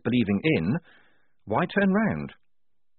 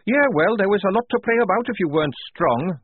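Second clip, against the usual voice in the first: high, loud, bitter outburst; angry growl; 11 semitones higher, 5 dB louder than usual. A narrator's reading. Tonka on praying at the Grey House, growling angrily. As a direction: throw it back hard, pitch way up. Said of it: "growled Tonka".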